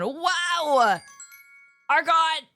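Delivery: loud, high excited yell; excited shout. Real, not acted. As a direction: shout with delight. Reacting to gifted subs.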